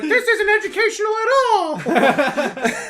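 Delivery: high, slow, cartoon mouse squeak; Mickey Mouse voice. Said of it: "That sounds like Mickey Mouse".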